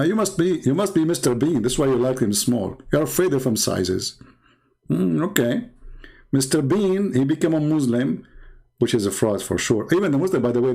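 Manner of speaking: ordinary talk, nothing marked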